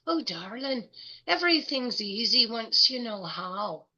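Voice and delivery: put-on Scottish accent, voice lifting on the second phrase; thick Scottish accent. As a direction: imitate a Scotswoman's thick accent. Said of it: "in her thick Scottish accent".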